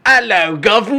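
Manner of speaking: high, loud cartoon Cockney greeting; over-the-top fake British; mock British accent. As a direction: belt out a hammed-up British hello. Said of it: "talking in a British accent".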